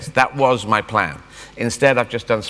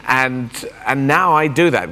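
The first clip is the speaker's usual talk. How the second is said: ordinary talk, nothing marked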